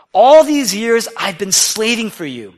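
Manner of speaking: loud, slow bitter complaint; resentful son. Acted. Elder son complains to his father, loud and bitter. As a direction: spit out the grievance.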